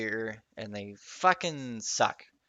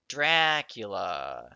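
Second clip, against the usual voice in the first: slow, louder drawn-out Dracula; dramatic; 2 semitones lower, 6 dB louder, much slower than usual. Acted. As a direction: announce Dracula like a horror title. Announcing the play title.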